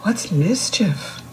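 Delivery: plain reading voice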